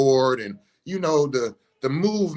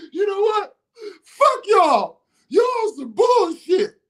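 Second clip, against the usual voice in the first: high, loud angry outburst; furious, high-pitched retold tirade; cussing everybody out; 6 dB louder than usual. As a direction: jump up and cuss out the whole room. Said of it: "I jumped up and cussed everybody out".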